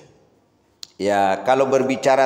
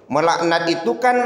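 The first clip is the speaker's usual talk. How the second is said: normal talking voice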